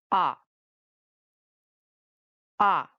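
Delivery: short "ah" falling sharply; fourth, falling tone. Shown as a demonstration. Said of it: "it's a four tone".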